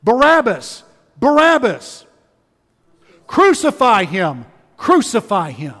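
the crowd as heard from Barabbas's prison, shouting; mob shout. Retold or imitated. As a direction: roar it like an angry mob. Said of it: "all Barabbas hears is this".

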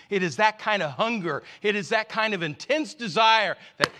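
rising, loud, fervent sermon delivery; impassioned preaching. Acted. Preacher urging the congregation toward desire, in full impassioned preaching. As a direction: preach it with building urgency.

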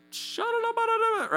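high-pitched rapid babble; mock tongues-praying. Imitating a Korean woman praying in tongues behind him, high fervent babble. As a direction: go for it in nonsense syllables. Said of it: "she's just going for it".

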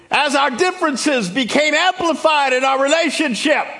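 high, loud, fired-up preacher; full-force preaching. Real, not acted. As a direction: preach it out at a shout to the whole congregation.

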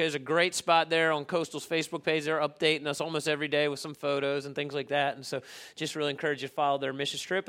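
usual speaking voice

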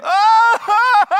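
loud, high, drawn-out wail; wailing cry. Shown as a demonstration. Showing the congregation what Hebrew weeping sounds like, a loud high wail. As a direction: let out a sudden anguished wail. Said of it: "Weeping alone is just this wailing".

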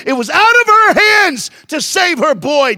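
preaching shout. Real, not acted. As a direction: drive the point home at full force. Preaching climax.

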